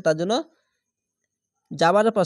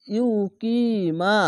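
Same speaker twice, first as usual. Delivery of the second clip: very slow drawn-out recitation; Quran recitation; 4 semitones higher, much slower than usual. Shown as a demonstration. Teacher showing students the recited word, slowly drawn out. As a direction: stretch each syllable like tajweed. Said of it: "এক আলিফ টেনে পড়বেন".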